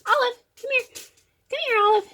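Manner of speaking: high-pitched voice for a pet; coaxing a cat. Real, not acted. Cat owner to Olive the cat, high coaxing call. Calling her cat over.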